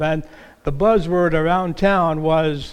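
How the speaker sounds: ordinary talk, nothing marked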